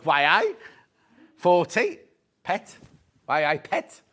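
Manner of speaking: playful put-on Geordie accent; jokey Geordie questioning. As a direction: turn it into a Geordie catchphrase. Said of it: "Why are you doing that accent?".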